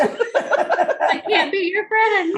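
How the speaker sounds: high, slow pouting voice; mock whiny voice. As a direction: whine it like a sulky kid. Comic high stretched voice.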